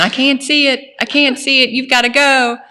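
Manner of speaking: panicked plea. Retold or imitated. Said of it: "And I tell my husband".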